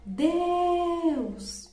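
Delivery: drawn-out high cry, falling at the end; imitated shout. Showing viewers the man's voice in the audio, imitated shout. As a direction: imitate a man calling out, pitched well up. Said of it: "uma voz de um homem gritando".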